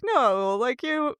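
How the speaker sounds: ordinary talk, nothing marked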